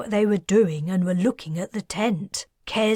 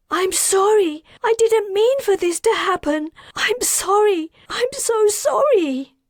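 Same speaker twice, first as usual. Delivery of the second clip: much higher, tearful, broken voice; sobbing apology; 14 semitones higher, 6 dB louder than usual. A narrator's reading. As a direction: apologise through sobs.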